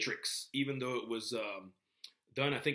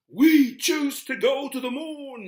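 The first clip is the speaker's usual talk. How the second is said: put-on slow, loud Boston accent, pitched up high; Kennedy's Boston accent; 17 dB louder, slower than usual. Lecturer quoting Kennedy's moon speech in Boston accent. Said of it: "in his Boston accent".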